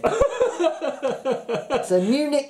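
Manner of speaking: laughing through the words; giggling, barely holding it together. Real, not acted. Laughing at crude joke.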